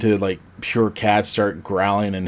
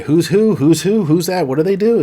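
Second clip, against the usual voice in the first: higher, rapid-fire baffled questions; hammed-up bewilderment, pitch climbing; mock confusion; 7 semitones higher, 5 dB louder than usual. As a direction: play an overwhelmed viewer losing track. Acts out a confused movie viewer.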